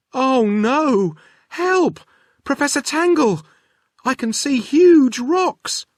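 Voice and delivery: high, loud cry for help; frightened call. Acted. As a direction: scream out in alarm at the rocks. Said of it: "she called".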